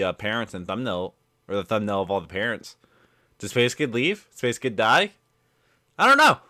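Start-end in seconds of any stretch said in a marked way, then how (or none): 6.0-6.4 exclaiming. Real, not acted. Puzzled about the ending.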